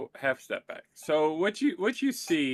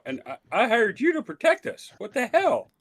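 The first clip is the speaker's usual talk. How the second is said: higher, louder acted exasperation; indignant outburst; 9 semitones higher, 5 dB louder than usual. Client berates his hired protector, indignant. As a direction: demand an explanation, pitch jumping up.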